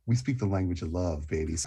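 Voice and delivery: low, soft, quick croon; low seductive voice. Acted. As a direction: purr it like a lover. Seductive line to women.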